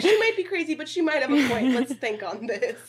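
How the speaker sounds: cheerful conclusion; happy medium. Shown as a demonstration. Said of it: "that's my happy medium".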